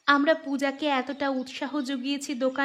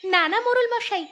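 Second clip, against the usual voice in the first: eager refusal; 7 semitones higher than usual. Acted. Eager refusal of more money.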